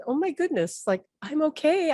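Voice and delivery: high-pitched retold protest; insist, amazed at all the fuss; taken aback. Retells reassuring a worried friend.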